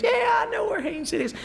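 high, mock-annoyed woman's voice; mock lady's reply. Preacher imitates the woman at the door, high and mock-annoyed. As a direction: pitch it up as a put-out housewife. Lady answers at her door.

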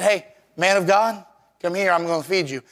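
ordinary talk, nothing marked

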